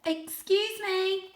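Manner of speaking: high, slow, careful little voice; timid polite call. Acted. A young cat asks the sleeping monsters, slow and timid. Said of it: "he said".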